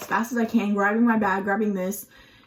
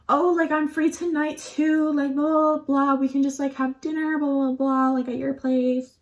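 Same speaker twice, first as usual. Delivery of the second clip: mock flirty; 4 semitones higher than usual. Mocking her own flirty text.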